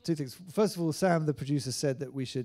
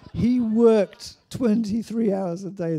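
louder and slower, higher, every word pushed hard; emphatic outburst; 8 semitones higher, 13 dB louder, slower than usual. Real, not acted. Defending the producer against rumours, loud and slow. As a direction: slam home how hard the man worked.

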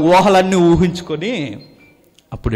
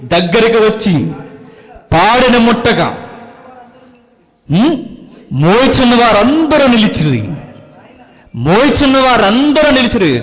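high, loud preaching of the verse; scripture proclaimed; 9 semitones higher, 6 dB louder than usual. Acted. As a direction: boom the verse out to the congregation. Preacher reads scripture at full force. (బిగ్గరగా వచనం ప్రకటించడం)

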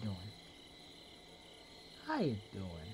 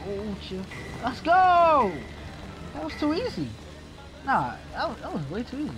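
excited; 12 semitones higher, 8 dB louder than usual. Real, not acted. Excited escape in the car.

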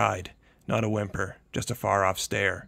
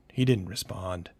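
plain reading voice

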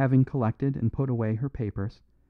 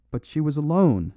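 objecting; 4 semitones higher, 3 dB louder than usual. A narrator's reading. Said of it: "objected the detective".

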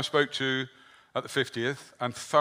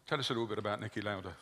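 off-mic, distant and quieter; speaking away from the microphone; mic handed over; 7 dB quieter, faster than usual. Real, not acted. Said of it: "Let me hand the microphone over".

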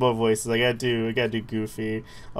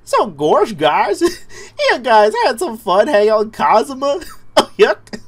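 loud, high goofy cartoon voice; 9 semitones higher, 8 dB louder than usual. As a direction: ham it up like Goofy. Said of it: "gotta do Goofy voice".

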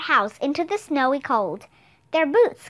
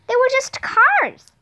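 laughing; 9 semitones higher, 5 dB louder, slower than usual. A narrator's reading. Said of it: "said Annie, laughing".

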